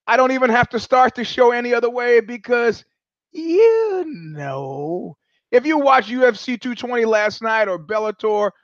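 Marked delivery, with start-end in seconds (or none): 3.3-5.2 long, drawn-out parrot punchline; drawling parrot voice; knowing parrot. Acted. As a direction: stretch both words out slowly like a taunting bird. Calls back the parrot's punchline.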